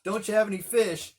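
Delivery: high, loud teasing hail; mock-cheery heckle; heckler calling out. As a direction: rib them from the shore. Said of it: "this heckler on the shore calling out".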